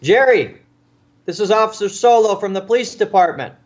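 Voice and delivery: retold police call-out, loud and high. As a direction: yell a name out, then announce yourself. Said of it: "he yells out to Jerry".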